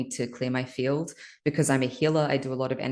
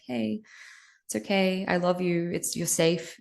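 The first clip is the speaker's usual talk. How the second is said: put-on higher, tender comfort to a crying child; soothing inner child; 5 semitones higher than usual. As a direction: hush a frightened little one. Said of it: "would you just sit with that child until they felt better and be like".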